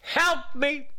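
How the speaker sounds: high, pleading cry for help; a plea for the listener to echo; desperate plea. Shown as a demonstration. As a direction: model the prayer as a plea. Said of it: "Maybe that's your prayer today".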